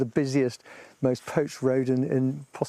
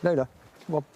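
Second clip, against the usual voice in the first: calling dogs; 7 semitones higher, much faster than usual. Real, not acted.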